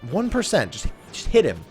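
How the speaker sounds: ordinary talk, nothing marked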